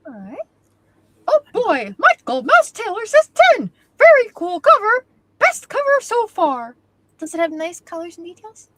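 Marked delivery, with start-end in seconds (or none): 1.3-6.8 high, loud, hammed-up score announcement; silly character voice. Co-host reading a viewer's score from chat, loud silly voice. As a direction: belt it out in a goofy high voice.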